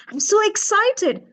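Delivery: high, loud, smiling thrill; drawn-out, exuberant excitement; excited. Shown as a demonstration. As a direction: smile and let the voice leap up. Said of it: "I smile, I raise my eyebrows".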